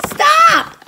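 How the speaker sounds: very high, loud command; angry order. Real, not acted. Parent fed up with the children, loud high-pitched order. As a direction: cry out sharply to make them stop.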